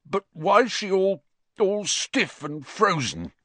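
high, grief-stricken wail; distraught, strangled voice; choked. A narrator's reading. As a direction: choke out a bewildered question high up. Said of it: "choked Filch".

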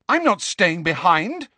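flatly refuse, furious. A narrator's reading. Hermione to Harry, furiously refusing to stay. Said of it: "said Hermione furiously".

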